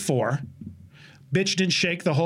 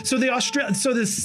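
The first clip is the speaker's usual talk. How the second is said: ordinary delivery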